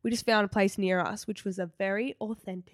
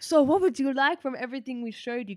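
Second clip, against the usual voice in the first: put-on higher, a light Greek accent; imitating a waiter taking the order; mock Greek host; 4 semitones higher than usual. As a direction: ask politely like an eager waiter. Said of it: "they were like".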